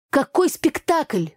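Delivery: indignant. A narrator's reading. Igor indignantly questions her accusation of playacting. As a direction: bristle at the word. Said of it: "возмутился Игорь".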